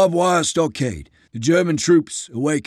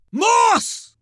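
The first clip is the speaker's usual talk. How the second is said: high holler after a runaway. A narrator's reading. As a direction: yell the name into the distance. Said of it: "Bert hollered after him".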